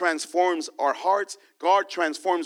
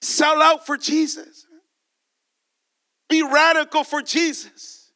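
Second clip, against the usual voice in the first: rising exhortation, louder each line; altar call; 6 semitones higher, 5 dB louder than usual. Acted. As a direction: urge them to surrender, building.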